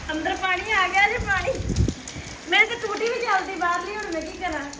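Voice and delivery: loud and high, flustered; loud panicked exclaiming. Real, not acted. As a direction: panic about the water. Water coming inside, panic.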